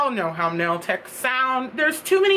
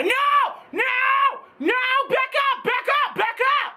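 high, frantic shouted no and back up; yelling back up; 11 semitones higher than usual. Retold or imitated. Nail tech shrieking at customers to back up. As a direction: shout them back from the door.

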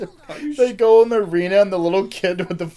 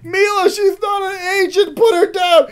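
high, loud laughing plea; pleading shout; 12 semitones higher, 8 dB louder than usual. Retold or imitated. Begging Mila to put the kid down, shouting. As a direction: beg Mila loudly through laughter. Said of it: "acted plea to Mila".

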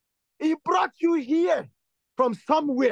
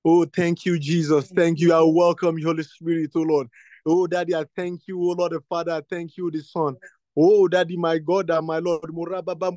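low, intense prayer voice, calling on God; fervent prayer; 7 semitones lower than usual. Acted. Pastor praying aloud over the congregation, low and fervent. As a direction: pour it out to God in worship.

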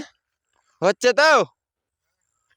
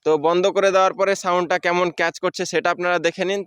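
ordinary talk, nothing marked

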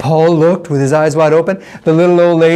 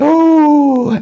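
crowd ooh; 8 semitones higher than usual. Acted. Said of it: "everybody went".

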